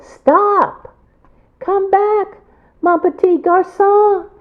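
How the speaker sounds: high, loud shouting after him. A narrator's reading. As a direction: call out desperately as you chase.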